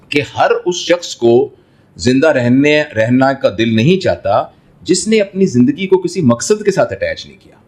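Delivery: normal talking voice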